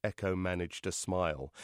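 ordinary delivery, nothing marked

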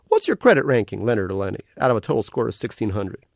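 cartoon otter greeting a new arrival, all sugary cheer; carnival otter voice. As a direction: gush like a cute carnival mascot.

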